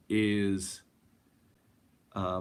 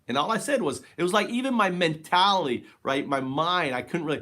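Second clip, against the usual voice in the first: excited; 6 semitones higher, 5 dB louder than usual. Real, not acted. Excitedly describing the trip.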